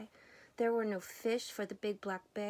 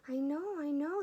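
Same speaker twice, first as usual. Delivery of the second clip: scolding; 7 semitones higher, 4 dB louder than usual. A narrator's reading. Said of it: "scolded the squirrel".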